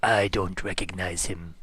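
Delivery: slow, low, lightly voiced dark character; a hint of a gloating sinister voice; necromancer voice. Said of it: "looking at it and goes".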